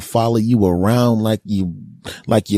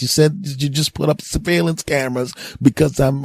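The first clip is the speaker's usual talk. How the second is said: ordinary talk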